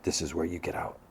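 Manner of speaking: pained, quiet dismissal in the car; quietly. Retold or imitated. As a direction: say it quietly, like it hurts to. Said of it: "he kind of quietly is like".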